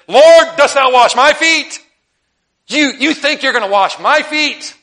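Peter, aghast that Jesus would wash his feet; Peter's indignant protest. Retold or imitated. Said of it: "Peter said to him".

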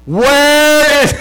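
very high, loud mock shout; loud imitation of talking to foreigners. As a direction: shout slowly at someone who can't understand. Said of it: "I'd get louder".

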